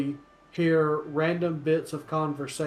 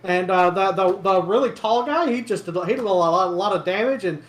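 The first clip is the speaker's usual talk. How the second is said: lightly voiced townsperson, higher and louder, rambling on; gossipy local getting worked up, slightly raised voice; chattering rumor-monger; 5 semitones higher, 7 dB louder, much faster than usual. Local recognizing Horton from the arena, lightly excited chatter.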